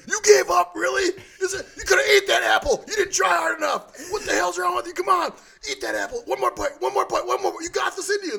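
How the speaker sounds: high, shouted coach rant; yelling coach. Retold or imitated. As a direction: scream it like a pushy coach. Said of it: "you can tell Rob's a coach 'cause he's like".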